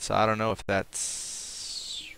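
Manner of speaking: usual speaking voice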